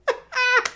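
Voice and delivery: short squealing giggle; a breathless high giggle. Real, not acted. Streamer giggles at the dancing player, high and breathless.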